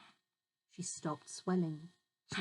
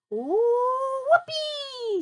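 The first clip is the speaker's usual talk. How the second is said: high, loud gleeful whoop; shouted whoopee; 8 dB louder, much slower than usual. A narrator's reading. Grandma shouting with joy as she rises. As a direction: whoop it with wild glee. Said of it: "she shouted".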